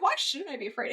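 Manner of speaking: half-acted film quote; mock German line. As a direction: lightly voice the actor staring at camera. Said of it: "In German".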